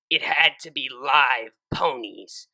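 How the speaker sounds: usual speaking voice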